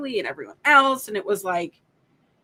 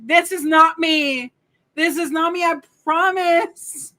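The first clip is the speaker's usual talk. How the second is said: pitched up, pleading mock protest, slowing on I promise; flustered denial; 6 semitones higher, 5 dB louder than usual. To fans sending the article, high flustered mock denial. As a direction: insist it's not you, half laughing. Denying being the stalker.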